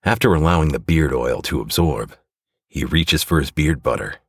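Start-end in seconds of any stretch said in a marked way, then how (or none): none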